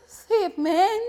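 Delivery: high-pitched mock local accent; exaggerated Yoruba movie accent; mimicked local accent. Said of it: "he said".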